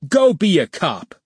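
high, jeering outburst; sarcastic yell. A narrator's reading. Furious boss taunting his employee, a sarcastic yell. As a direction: throw it out as a mocking, high-pitched dare. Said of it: "Sonny yelled at John, his voice dripping with sarcasm".

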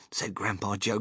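plain reading voice

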